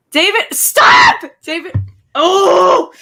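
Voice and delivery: high, loud tearful cry of his name; crying shout. Real, not acted. Fan seeing the actor's post, crying shout. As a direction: shriek through tears.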